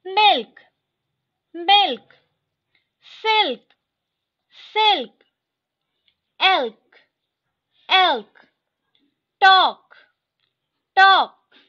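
each word said twice, loud and crisp; teacher drilling vocabulary, clear and loud; word drill reading. Shown as a demonstration. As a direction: read the list aloud for the class to repeat. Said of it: "मैं line by line ऐसे पढ़ते जाऊंगी".

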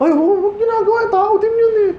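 very high mock falsetto gasp; a hammed-up "don't do that, he's human too"; mock shocked defender. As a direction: squeal like a scandalized onlooker. Mocking the victim's defenders.

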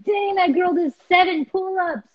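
put-on high-pitched awestruck kid voice; mock scared boys. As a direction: imitate boys spooked by a tough girl. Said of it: "were they scared of you".

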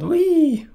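very high, loud whistled wee; friendly whistle. A narrator's reading. Chuck greeting the visitors with a high whistle.

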